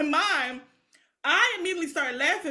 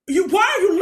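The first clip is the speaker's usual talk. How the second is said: louder, higher mock angry shout; imitating her sister's mad yell; mad outburst; 5 semitones higher, 6 dB louder than usual. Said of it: "she was so mad".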